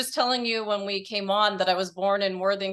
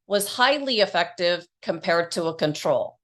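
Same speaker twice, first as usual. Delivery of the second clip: polished, louder announcer-style intro; podcast intro read; 5 dB louder than usual. Acted. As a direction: read it like a show opener. Host reads podcast intro.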